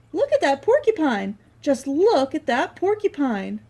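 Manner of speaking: lightly voiced excited Porcupine; delighted Porcupine. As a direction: marvel at the painting. Porcupine admiring painting.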